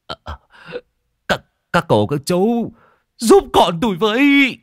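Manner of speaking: stammering, rising to a loud tearful cry for help; tearful stammering plea. A narrator's reading. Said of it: "Ông Tư thì ở bên mếu máo". (giọng mếu máo van xin, càng lúc càng to)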